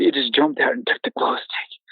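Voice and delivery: shaky frightened whisper; scared whisper. Retold or imitated. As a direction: whisper it, still terrified. Describing creature in fear.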